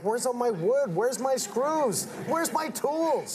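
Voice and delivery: high, slow baffled dad hunting for his tools; mocking his dad. Son imitating his dad searching for missing tools, high and slow. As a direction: mimic a puzzled father. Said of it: "He's like".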